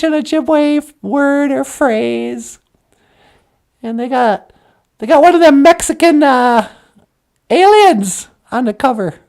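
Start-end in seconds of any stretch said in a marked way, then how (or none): none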